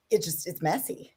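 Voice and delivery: usual speaking voice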